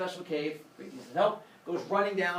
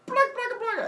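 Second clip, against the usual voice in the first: much higher squeaky monster babble; monster gibberish; 4 dB louder than usual. Acted. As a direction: squeal the nonsense like a goofy monster. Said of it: "And they go".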